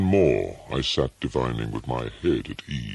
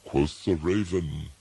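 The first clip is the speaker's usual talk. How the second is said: usual speaking voice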